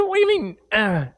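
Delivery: high, astonished question; can't believe his ears; incredulous. Retold or imitated. Pastor retelling his shocked question to his wife. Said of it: "I said".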